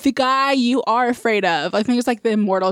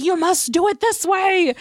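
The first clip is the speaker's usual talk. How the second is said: mock yakuza yell; 8 semitones higher than usual. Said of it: "he screams at".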